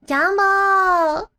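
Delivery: put-on high childlike call of Jumbo; yelling his name. Yotsuba calling to Jumbo across the shop. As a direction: shout the name as a happy child. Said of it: "she's just yelling out his name".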